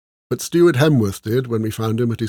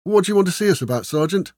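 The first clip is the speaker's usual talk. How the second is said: higher-pitched, chastened soldiers; chastened reply; 6 semitones higher, 3 dB louder than usual. A narrator's reading. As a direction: answer the sergeant like a scolded recruit. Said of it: "we mumbled in reply, chastened".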